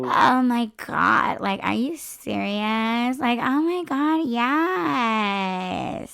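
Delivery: slow, drawn-out mock gushing; mock a podcast girl stretching every last word. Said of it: "girls were talking like this".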